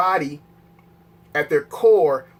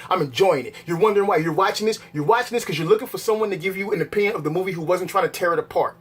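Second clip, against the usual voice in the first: ordinary talk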